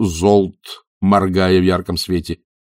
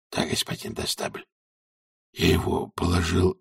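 whispering; 3 semitones lower, 9 dB quieter than usual. A narrator's reading. Whispered talk at stage edge.

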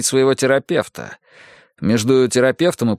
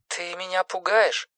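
high, soft voice on the line; uneasy phone voice; 9 semitones higher, 6 dB quieter than usual. Acted. Simone tells Erik he scares her, high uneasy phone voice. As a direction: say it high and softly through a telephone. Симона напугана, по телефону.

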